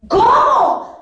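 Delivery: very high, loud shocked cry; shocked priest. Retold or imitated. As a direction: shout it in total disbelief. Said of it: "le empiezo a mandar WhatsApp al padre Quique". (el padre Quique grita escandalizado)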